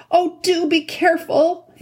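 high, drawn-out call of warning; anxious shout. A narrator's reading. Susan calls out to the sword fighters, anxious shout. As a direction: cry out a slow, high-pitched plea. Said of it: "shouted out".

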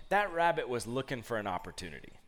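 ordinary delivery

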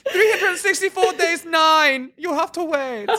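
put-on high German accent, breaking into laughter; stern German official, hammed-up in German accent; mock German accent. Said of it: "your grasp of accents".

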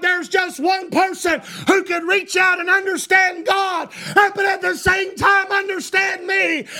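high, driving preacher's shout; full-force preaching. Real, not acted. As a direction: hammer each declaration home to the congregation.

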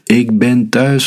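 high, drawn-out call into the house; narrated shout, high and slow; mild storybook yell. A narrator's reading. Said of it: "gilde ze".

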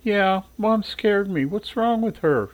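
put-on high, slow, hushed child voice; a frightened girl, voice small; little sister shaken by her mother's outburst, slow and high; scared little sister.

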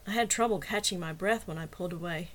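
ordinary delivery, nothing marked

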